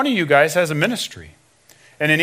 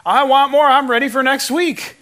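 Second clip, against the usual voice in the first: higher-pitched keen convert; eager hunger; 7 semitones higher than usual. Retold or imitated. New convert wanting next week's teaching, eager. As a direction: imitate an eager listener, pitched up.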